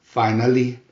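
plain reading voice

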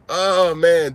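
normal talking voice

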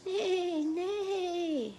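high, loud neigh; whinny up high like a horse. Retold or imitated. Teacher to kids about horses, high loud neigh.

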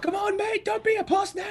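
hammed-up Cockney drinker jeering; Cockney accent. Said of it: "that's more of a Cockney accent".

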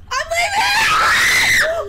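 high slow panicked yell. Real, not acted. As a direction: yell and flee in terror. Ghost appears, flees screaming.

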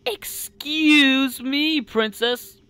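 high, drawn-out "excuse me"; indignant surprise. Acted. Princess to eavesdropping computer, high affronted surprise. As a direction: stretch it out slowly, pitched well up.

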